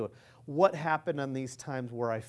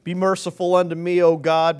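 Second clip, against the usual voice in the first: loud scripture cry; 2 semitones higher, 10 dB louder than usual. Shown as a demonstration. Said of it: "So then read Psalms fifty-seven".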